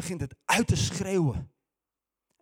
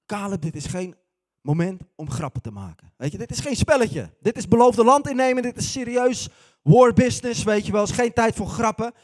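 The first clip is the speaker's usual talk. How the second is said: loud, higher, stern scolding; stern rebuke; 4 semitones higher, 9 dB louder than usual. Acted. Jozua rebuking Caleb for joking, loud and stern. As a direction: put him firmly in his place.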